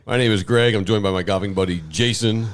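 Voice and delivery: usual speaking voice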